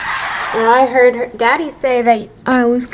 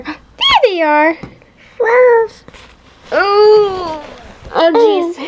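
surprised; 8 semitones higher, 4 dB louder, much slower than usual. Real, not acted. Finding pajamas, surprised.

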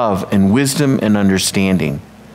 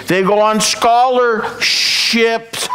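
higher, slow drawn-out punchline; punchline delivery; 9 semitones higher, much slower than usual. Acted. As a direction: stretch the payoff word out.

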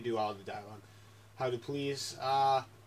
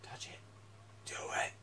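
whisper; 11 dB quieter than usual. Real, not acted. Whispering to himself.

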